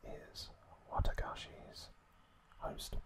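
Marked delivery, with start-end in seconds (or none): none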